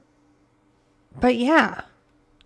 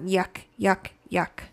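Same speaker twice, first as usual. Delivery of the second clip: softer, grossed-out yuck yuck; disgusted yuck; 2 semitones lower, 6 dB quieter, slower than usual. Real, not acted. Host reading about bugs, quietly grossed out. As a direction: shudder through the yucks, trailing quiet. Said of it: "I don't wanna read about that".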